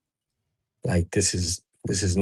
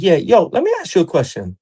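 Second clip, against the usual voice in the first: put-on higher, keen kid's voice; the fighter calling late, all curious; eager young fighter; 8 semitones higher than usual. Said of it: "And he'll be like".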